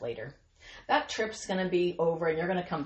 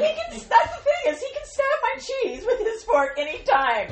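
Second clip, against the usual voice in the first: much higher and louder, laughing through it; cracking up while defending her husband; laughing about her husband's fork; 7 dB louder than usual. Real, not acted. Host laughing at the joke.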